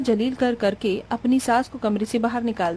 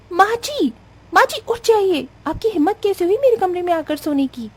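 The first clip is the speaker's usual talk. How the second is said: furious bride; 8 semitones higher than usual. Acted. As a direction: yell her out of your bed. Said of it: "गुस्से के मारे आग बबूला".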